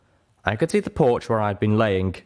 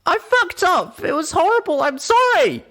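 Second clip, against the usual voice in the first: very high, loud crying outburst; sobbing yell; 7 dB louder than usual. Acted. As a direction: break down wailing. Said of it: "bursting into tears".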